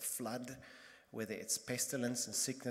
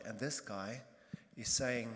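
ordinary delivery

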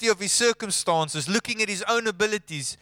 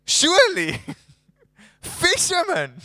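incredulous; 7 semitones higher, 5 dB louder than usual. Real, not acted. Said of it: "preacher's incredulous riff".

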